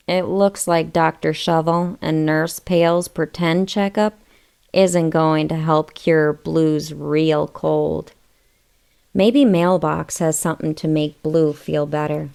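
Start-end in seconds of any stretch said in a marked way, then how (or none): none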